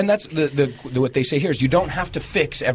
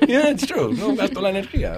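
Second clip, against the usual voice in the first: ordinary talk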